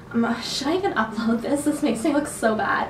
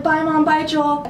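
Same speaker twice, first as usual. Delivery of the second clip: higher, mildly called-out goodbye; retold calling out; 6 semitones higher, 4 dB louder than usual. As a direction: lightly imitate calling back as you run. Calling goodbye while running off.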